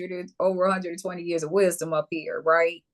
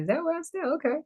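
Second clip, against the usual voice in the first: mock quote; 12 semitones higher, faster than usual.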